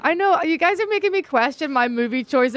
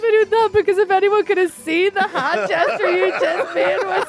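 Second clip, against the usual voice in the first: high-pitched laughing talk; 6 semitones higher than usual. Real, not acted. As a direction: talk through giggles. Laughing at hand gesture.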